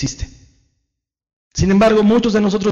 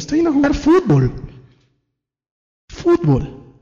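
squeaky mock protest; 9 semitones higher than usual. Imita voz aguda defendiendo el fútbol.

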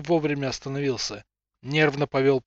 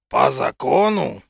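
slow, loud growl; 6 dB louder, slower than usual. Acted. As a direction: snarl the words back at him. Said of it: "прорычал он пуще прежнего".

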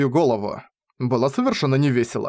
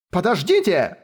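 higher woman's cry; wailed cry; 5 semitones higher than usual. A narrator's reading. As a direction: cry out for them to wait. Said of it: "возопила другая женщина".

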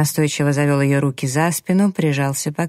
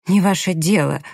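ordinary delivery

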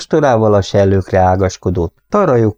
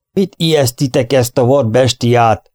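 put-on raised, gruff old man's rebuke; snapping at them; 5 semitones higher than usual. Old Bob snapping at the armed cowboys, raised and gruff. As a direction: tell off the guards sharply. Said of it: "förmet rájuk az öreg".

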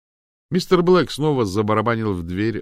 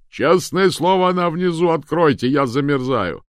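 high, loud pleading through a door; frantic, freezing man's holler; desperate shouting; 9 semitones higher, 9 dB louder than usual. A narrator's reading. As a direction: yell to be let in from the gale. Shouting through door over wind.

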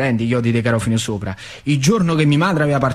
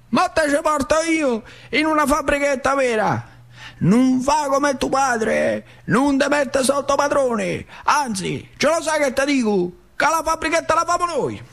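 much higher, slow persuading uncle; zio Peppe; 12 semitones higher than usual. Acted. Uncle tempting the nephew into their own workshop, high coaxing voice. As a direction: coax the boy with a big promise, voice pitched up. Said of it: "Me fa zio Peppe".

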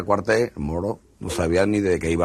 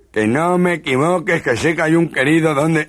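angry threat; 9 semitones higher, 4 dB louder than usual. Acted. Jealous husband threatening his wife, angrily. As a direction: threaten her into telling.

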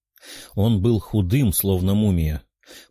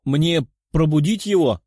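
loud question; 5 semitones higher than usual. A narrator's reading. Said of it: "громко спросил мужчина".

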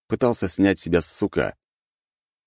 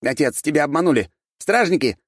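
shouting prince; 10 semitones higher, 4 dB louder than usual. A narrator's reading. Prince calls for help.

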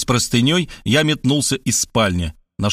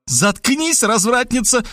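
higher female voice demanding silence; commanding woman; 10 semitones higher, slower than usual. Acted. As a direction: snap the order at the girl. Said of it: "потребовала Светлана". (высокий женский приказ)

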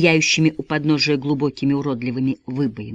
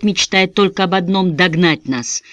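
ordinary delivery

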